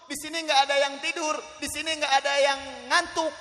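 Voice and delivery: high, loud proclamation; loud insistence. Real, not acted. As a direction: declare it forcefully to the whole crowd. Repeats the claim emphatically to crowd.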